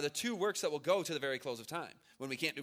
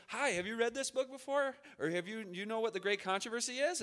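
put-on little boy voice; 6 semitones higher than usual. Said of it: "He goes".

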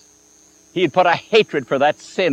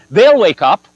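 louder, ringing certainty; confident exclaim; 4 semitones higher, 8 dB louder than usual. Retold or imitated. To the doubting young minister, boldly louder and certain. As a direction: toss it out like a sure promise. Preacher answering at the dark house.

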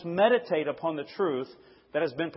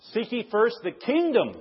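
"kingdom" raised high at the end, then falling; stress on kingdom; 6 semitones higher than usual. Shown as a demonstration. Preacher explaining God's realm, stressing "kingdom". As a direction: save the weight for "kingdom". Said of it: "I emphasize every single word".

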